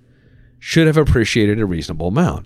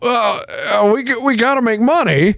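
high, dopey advisor voice; whiny advisor voice; 12 semitones higher than usual. Retold or imitated. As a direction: play a clueless rep, pitched high. Quoting the fund manager.

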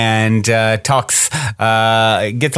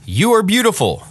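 high, contained call of praise; storyteller calling out as the father; mild mock shout; 9 semitones higher than usual. A narrator's reading. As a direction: lift the pitch as if calling to a mountain. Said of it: "My father shouted".

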